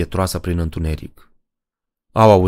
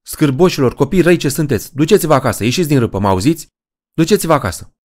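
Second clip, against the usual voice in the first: shouting; 8 semitones higher, faster than usual. Acted. Francine scolds kids playing in the ravine, yelling. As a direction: chase the children off with a sharp yell. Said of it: "Francine strigă".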